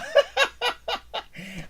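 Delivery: high-pitched amused laugh. Real, not acted. Waiting on Eric's reply, high laugh.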